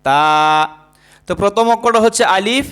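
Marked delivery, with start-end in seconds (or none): 0.0-0.7 তা said very slowly and lower, drawn out; slow model letter. Shown as a demonstration. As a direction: stretch তা for the class to copy. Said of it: "আবার বলেন". (ধীরে টেনে তা উচ্চারণ)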